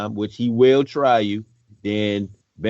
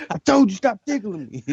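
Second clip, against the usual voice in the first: high, laughing protest; giggling plea to stop; being tickled; 12 semitones higher than usual. Retold or imitated. Acting tickled man lashing out.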